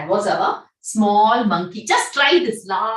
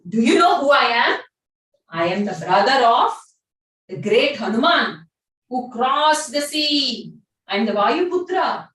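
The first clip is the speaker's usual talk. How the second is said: arrogant shout. Acted. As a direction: puff up and shout your lineage. Bhima boasts angrily to monkey.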